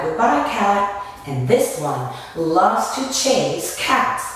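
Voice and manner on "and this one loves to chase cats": ordinary talk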